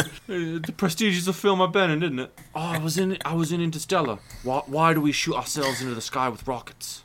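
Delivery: lightly hammed-up Cockney film star; Michael Caine. Playing the actor recalling his films, mock-Cockney. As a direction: put on a chatty old English actor. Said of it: "we're just talking about Michael Caine now".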